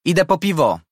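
shouting over music. A narrator's reading. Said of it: "krzyknęła do koleżanki".

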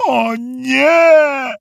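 high, drawn-out groan of despair; dismayed groan. A narrator's reading. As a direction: groan it long and high into a pillow. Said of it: "jęknął Felek, przykrywając twarz poduszką". (wysoki, przeciągły jęk rozpaczy)

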